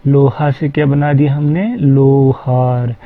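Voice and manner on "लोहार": लोहार said very slowly with a long o; teacher stretching लोहार; long o demo. Shown as a demonstration. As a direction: drag out the o in लोहार. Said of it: "ओ थोड़ा लंबा खिंच गया".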